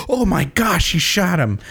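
high-pitched mock gasp of shock; acted-out astonishment; shocked reaction. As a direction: react like a startled viewer. Voices viewer's reaction to shooting.

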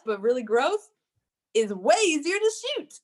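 ordinary delivery

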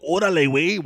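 loud, high Mexican Spanish greeting; Mexican slang call. Retold or imitated. Brother explaining how Mexicans greet, loud Mexican call. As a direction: shout it like a Mexican buddy. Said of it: "if they go".

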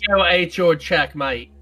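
slow mock Aussie drawl; a light fake Australian accent. Joking along in Australian mockery.

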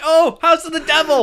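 high, loud and cracking up; amused mock reaction, pitch jumping up; laughing mock exclamation. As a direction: blurt it out through laughter.

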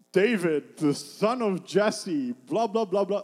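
loud, slow mock grandeur; mock self-introduction. What David is not saying about himself, mock grand introduction. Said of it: "He's not saying".

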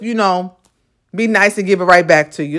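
normal talking voice

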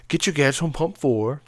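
a tentative stab at British shop talk; attempted British accent. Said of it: "in British accents".

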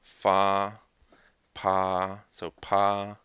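Fa and pa with an open ah, as in father; sounding out the vowel. Shown as a demonstration. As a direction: stretch the ah of Fa and pa. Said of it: "as they are in father".

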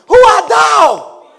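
booming Who art thou, slow; slow shouted challenge; loud demanding question. Acted. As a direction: thunder the question as old Isaac. Preacher voices blind Isaac questioning.

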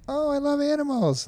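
put-on high, sing-song little kid gushing over animals. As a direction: squeak it like an excited small child. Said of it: "they're like".

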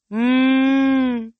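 long, loud, high-pitched closed-mouth moo; lowing moo. A narrator's reading. Bessie the cow serpent protests the S word, lowing. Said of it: "Bessie lowed".

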